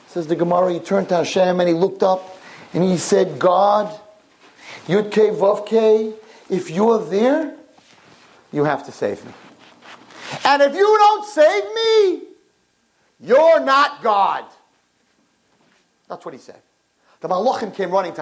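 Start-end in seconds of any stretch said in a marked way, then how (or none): 3.4-14.5 challenge. Acted. King challenging God from pot.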